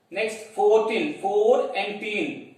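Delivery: put-on fourteen said slowly, then split into four and teen; slow syllable split. Shown as a demonstration. Teacher showing the children fourteen's two syllables, slowly. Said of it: "Two syllables".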